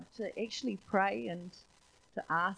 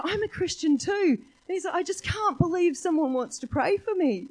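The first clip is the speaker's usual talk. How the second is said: higher, louder, thrilled voice; excited driver; 11 semitones higher, 9 dB louder than usual. Retold or imitated. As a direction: lift it up with the driver's joy. Excited Fijian driver quoted.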